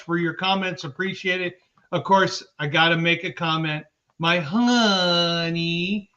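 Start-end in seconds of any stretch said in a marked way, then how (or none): 4.2-6.1 stretched-out, sing-song endearment; doting drawl. Real, not acted. Host thanks partner in the chat, doting drawl. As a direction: draw the word honey right out.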